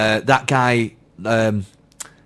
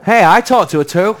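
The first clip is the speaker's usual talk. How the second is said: indignant protest; 6 semitones higher, 5 dB louder than usual. Real, not acted. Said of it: "very aggressive".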